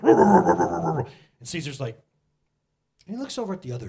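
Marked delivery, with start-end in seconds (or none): 0.0-1.1 loud, rolling big-dog growl; imitated dog growl. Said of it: "the dog's like".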